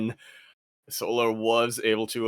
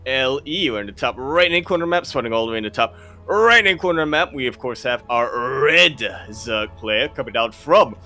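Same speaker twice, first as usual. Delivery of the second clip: hyped caster introduction, building louder and higher; big esports hype build; esports announcer intro; 4 semitones higher, 7 dB louder than usual. Real, not acted. Announces players and map.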